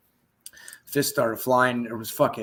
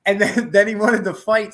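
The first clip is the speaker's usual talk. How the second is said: laughing; 8 semitones higher, 8 dB louder than usual. Real, not acted. Laughing retelling.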